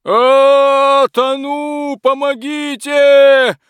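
very high, loud, slow cries for help; panicked scream. Acted. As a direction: scream for help while drowning. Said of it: "кричал рыцарь, барахтаясь".

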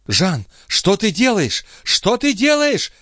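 high, drawn-out alarmed cry. A narrator's reading. Chon sees Jean taking the horses, crying out. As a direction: wail it high, slowing on each word. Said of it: "кричала Шон".

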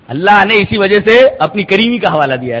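normal talking voice

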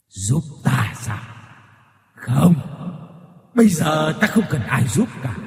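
eerie menacing ghost voice that builds into a sudden rush; a vengeful spirit promising torment; ma quỷ đe dọa rợn người; threatening ghost. A narrator's reading. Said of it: "nói với cái giọng hăm dọa".

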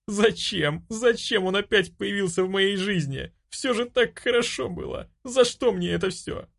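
high, tearful lament; anguished high-pitched wail; sobbing despair. A narrator's reading. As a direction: plead why through tears. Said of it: "разрыдалась".

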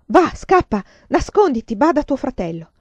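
loud, high-pitched urgent commands; ordine urgente. A narrator's reading. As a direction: drive the child away, starting high and forceful. Madre ordina di scappare. (comandi concitati, a voce alta)

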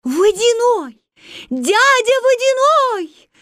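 high, loud calling out. Acted. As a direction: call across the river. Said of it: "стали звать русалки".

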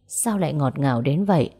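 ordinary delivery, nothing marked

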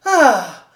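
a loud, long sigh of relief; the squire's loud sigh of relief at good news; relieved sigh. A narrator's reading. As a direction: let out all the tension in one breath. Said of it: "avec un long soupir de bien-être".

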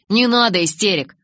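loud, mildly mock-horrified plea; playfully exaggerated alarm; mock horror. A narrator's reading. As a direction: feign dread at the threat. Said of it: "в притворном ужасе скривился Джейкоб".

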